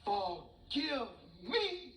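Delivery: high, drawn-out comic pleading; terrified mouse. Acted. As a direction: beg for mercy in a tiny panicked voice. Mouse begs the lion for mercy.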